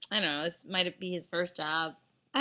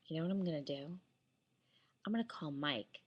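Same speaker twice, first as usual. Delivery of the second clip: quiet threat; 5 semitones lower, 11 dB quieter than usual. Retold or imitated. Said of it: "I swear this is how I'm talking".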